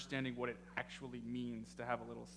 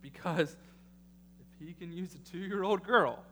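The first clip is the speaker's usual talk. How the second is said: rising preacher's build to the congregation; preaching; 3 semitones higher than usual. Acted. Pastor urging his congregation, building preaching. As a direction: drive the point home like a sermon.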